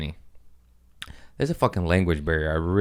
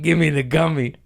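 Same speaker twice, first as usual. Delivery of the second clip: mock demanding shout; 8 semitones higher, 3 dB louder than usual. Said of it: "And I was like".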